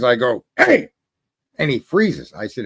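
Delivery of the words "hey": sudden huge high yell; shout at the top of lungs. Retold or imitated. Yelling up at a tall man to stop him. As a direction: bark it out to freeze him. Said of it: "out of nowhere at the top of my lungs".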